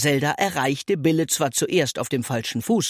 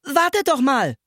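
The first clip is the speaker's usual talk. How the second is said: high, raised call to someone walking away; calling after them; 11 semitones higher than usual. A narrator's reading. Bille to the departing boys, calling out. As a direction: stop them from leaving. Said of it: "rief Bille den Jungen da hinterher".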